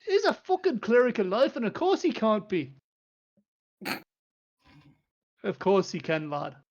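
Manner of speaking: a half-dressed dwarf lord losing patience; impatient dwarf. Acted. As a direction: Doran scolding the visitor. Dwarf answers.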